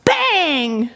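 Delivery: punched-out high comic-book bang; explosive exclamation. Acted. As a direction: burst it out like an impact. Sound effects of collision.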